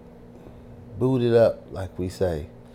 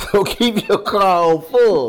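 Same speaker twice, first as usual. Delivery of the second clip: loud, high, laughing delivery; loud amused exclamation; laughing punchline; 9 semitones higher, 8 dB louder than usual. Real, not acted. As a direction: say it through a big laugh. Joking punchline after a laugh.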